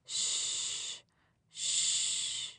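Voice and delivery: a breathy whispered shh, shh; hushing whisper. Acted. Family hushing everyone around the baby, whispering. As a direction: hush the family as if the baby might be heard.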